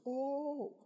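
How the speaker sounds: high, slow snooty voice; condescending doctor. Retold or imitated. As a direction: talk down to him.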